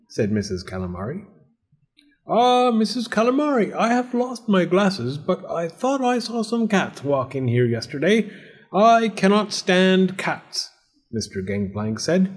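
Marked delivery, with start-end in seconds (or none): 2.3-10.7 high, theatrical storybook landlord; fussy cat-hating landlord. Acted. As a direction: voice a landlord who can't abide cats.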